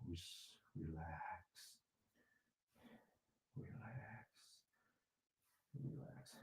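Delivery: soft slow whispered relax. Shown as a demonstration. Teacher showing the class how to whisper to themselves, whispering. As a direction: breathe the words out gently, barely voiced. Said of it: "whisper to ourselves by saying".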